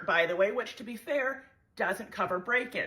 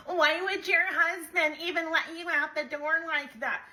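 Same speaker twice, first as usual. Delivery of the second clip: mock commenter; 8 semitones higher than usual. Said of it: "who's gonna be like".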